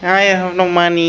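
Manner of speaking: a hint of a friend's pleading, mocking tone; imitating Al. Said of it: "I want with this voice".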